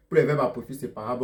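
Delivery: ordinary talk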